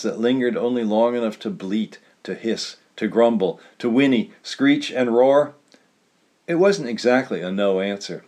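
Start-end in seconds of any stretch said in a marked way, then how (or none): none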